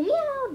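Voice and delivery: sung high meow. Acted. Meows mid-hum.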